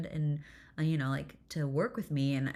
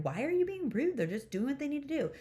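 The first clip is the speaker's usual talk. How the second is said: high-pitched acted scolding; mock lashing out; 10 semitones higher, faster than usual. Mom re-enacting scolding her daughter, mock lashing out. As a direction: replay a fed-up telling-off, pitched way up. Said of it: "I would lash out at her".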